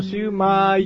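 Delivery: シューマイ with a long, stretched final マーイ; drawn out. Shown as a demonstration. Guest showing the pronunciation, シューマイ drawn out. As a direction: hold the vowel of マイ in シューマイ. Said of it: "伸ばすらしいですね".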